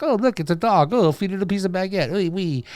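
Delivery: high, cooing at a dog; mock-sweet, high-pitched dog talk; delighted dog feeder. Voicing a fond dog feeder in Paris.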